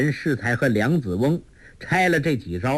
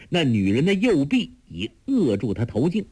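usual speaking voice

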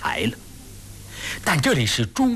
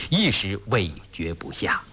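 plain reading voice

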